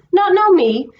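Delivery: high, loud, hurt disbelief; he can't believe his horse forgot him; indignant surprise. A narrator's reading. Cabby to his old horse, loud hurt disbelief.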